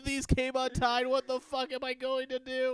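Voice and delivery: high, loud panicked wail; hammed-up tearful meltdown; mock crying kid. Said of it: "I started crying".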